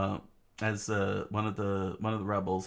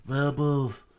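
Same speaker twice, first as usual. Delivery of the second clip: higher, loud cry of protest; struggling shout; 6 semitones higher, 9 dB louder, much slower than usual. Acted. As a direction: shout to stop your own side. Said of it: "struggled to get his word out".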